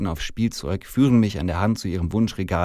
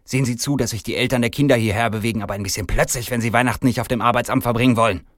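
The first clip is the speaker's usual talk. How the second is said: fast, raised, bossy manager; irate command; 4 semitones higher, 6 dB louder, faster than usual. Acted. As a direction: rattle off an angry threat. Faucht er.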